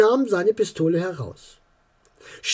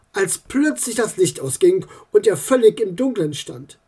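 ordinary delivery, nothing marked

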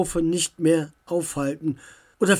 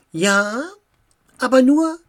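high, loud goblin voice; thin goblin; 7 semitones higher, 5 dB louder than usual. A narrator's reading. Thin goblin chiming in after his partner, high creature voice. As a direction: pipe up as the skinny goblin. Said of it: "sagte der dünne Kobold".

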